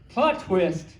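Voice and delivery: ordinary delivery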